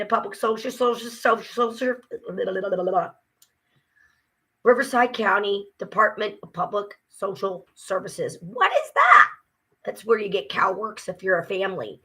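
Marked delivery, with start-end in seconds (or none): 4.6-9.3 shouted, rising at the end. Real, not acted. Ranting about the agency name, shouting. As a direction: shout the question in disbelief.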